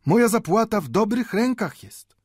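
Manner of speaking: put-on high-pitched old man's declaration; impassioned conclusion. A narrator's reading. Old Mendel sums up his argument to the watchmaker, impassioned.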